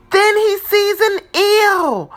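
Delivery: high, loud dramatic reveal; startled excitement. A narrator's reading. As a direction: jolt up at the eel. Eel surprise in cave.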